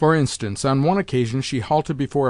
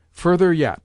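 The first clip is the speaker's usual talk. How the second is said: louder, higher insistent call; crying out; 5 semitones higher, 6 dB louder than usual. Acted. Old lady ordering the lackeys to pull the counterpane, loud. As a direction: push the lackeys on, loudly. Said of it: "the old lady cried".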